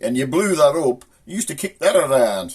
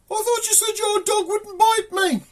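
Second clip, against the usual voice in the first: very high posh lady voice; hammed-up high posh protest; posh woman. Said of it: "this posh woman comes up".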